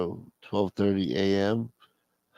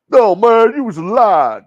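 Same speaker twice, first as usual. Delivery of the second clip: loud, high character yell; shouted objection; 9 semitones higher, 10 dB louder than usual. Acted. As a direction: protest loudly, voice jumping up. Acted character quarrel.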